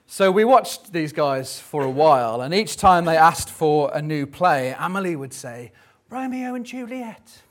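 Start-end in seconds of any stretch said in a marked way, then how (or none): 6.1-7.4 put-on high, soft little-girl voice, much higher pitch; piping like a shy seven-year-old. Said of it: "in her little seven-year-old girly voice".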